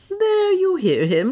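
amused adventurer, unhurried; Leonard. A narrator's reading.

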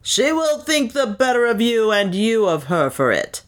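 a touch of rowdy cheer; a reveller's cry. A narrator's reading. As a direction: call out a lightly voiced toast. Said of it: "cried a voice".

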